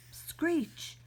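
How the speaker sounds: breathy whispered screech; voiceless screeching noise. Acted. Sound effect in comic reading.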